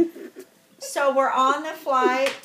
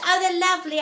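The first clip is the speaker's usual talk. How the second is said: higher, louder mock English lady; English accent; 5 semitones higher, 6 dB louder, much faster than usual. English lady admiring the pictures, higher imitated English accent. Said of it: "from England".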